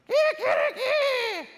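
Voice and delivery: high, loud, slow rooster crow in his voice. Retold or imitated. Host father crows his rooster call, high and loud. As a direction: stretch it out like a rooster crowing. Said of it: "he did his own impersonation for me".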